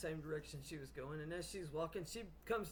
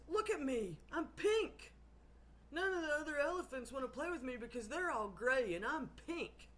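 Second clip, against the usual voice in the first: high, loud mock wail; tearful elephant; 7 semitones higher, 3 dB louder than usual. Crying pink elephant complains to the witch, tearfully wailing. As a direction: play a sorry-for-himself elephant, whining up high. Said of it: "He's crying his little eyes out".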